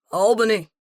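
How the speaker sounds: loud call across the street. Acted. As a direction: shout her name after her. Man calls after her on street.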